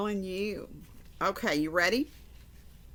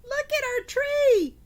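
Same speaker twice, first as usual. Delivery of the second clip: put-on much higher, louder kid voices calling to Grandma; excited children; 6 dB louder than usual. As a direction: squeal it like kids showing off their tree. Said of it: "and they said".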